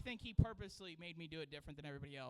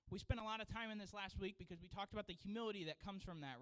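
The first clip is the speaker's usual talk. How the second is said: ordinary talk, nothing marked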